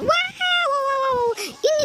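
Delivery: very high, drawn-out string of wows; amazed squealing. Pretend. Spotting many pop-its for young viewers, amazed squeal. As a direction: squeal with delight at a big find.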